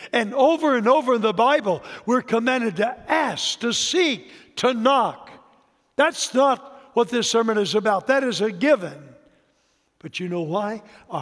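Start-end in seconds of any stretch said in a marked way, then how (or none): 0.1-9.1 quick, raised preaching run; emphatic preaching. Acted. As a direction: drive through the list at full preaching force.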